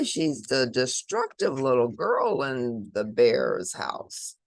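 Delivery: ordinary delivery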